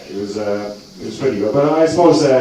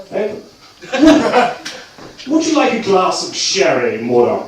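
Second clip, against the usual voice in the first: posh English slipping to Dublin; 9 semitones higher than usual. Retold or imitated. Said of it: "my Dublin accent came out every single time".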